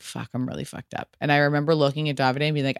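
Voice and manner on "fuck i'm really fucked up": much softer, low and defeated; muttered drunk. Retold or imitated. Bride realising she is drunk, soft and low. Drunk at her rehearsal dinner.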